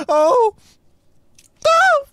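very high, loud, separate squeals; squealing delight. Real, not acted. Player adopting the dog, squealing delight. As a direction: squeal each word on its own.

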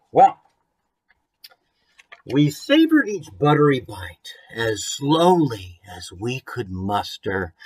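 picture-book reading with a lilt; rhyming storybook reading. A narrator's reading. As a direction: read the rhyme aloud to the kids.

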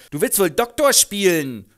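laut und höher, spöttisch-wütend. Acted. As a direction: fauch ihn an, er spielt sich auf. [name] wütend auf [name]. ([name] wütend zu [name], laut und höher)

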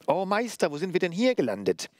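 high, squeaky mocking puppet; distorted puppet voice. A narrator's reading. Puppet mocking the executioner's apprentice, distorted high voice. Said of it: "sprach sie auch noch mit einer verzerrten Stimme".

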